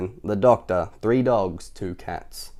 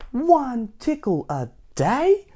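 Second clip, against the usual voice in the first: dismayed; 13 semitones higher, much slower than usual. Acted. Tickle dismayed, high slow.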